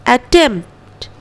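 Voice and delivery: अटेम्प्ट said atemt, p dropped; अटेम्प्ट slowly, m straight into t; silent p. Shown as a demonstration. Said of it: "पी को छोड़ते हुए". (पी छोड़कर अटेम्प्ट)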